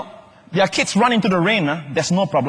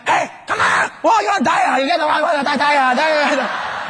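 a hammed-up parent yelling at the kid; hammed-up parent shout; scolding parent yell; 8 semitones higher than usual. Singapore parent scolding child.

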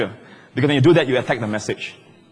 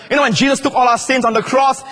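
usual speaking voice

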